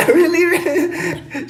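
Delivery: high, drawn-out echo through laughter; mock the question while cracking up; laughing mimicry. Repeats partner's line while laughing.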